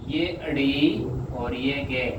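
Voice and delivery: walk the class through it letter by letter; slow dictation voice; slow letter-by-letter spelling. Shown as a demonstration. Teacher spells out shorthand letters.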